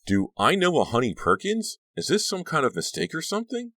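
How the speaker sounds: high, rising incredulous questions; baffled wondering. A narrator's reading. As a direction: puzzle over it, voice climbing. Becca inwardly baffled by the stranger.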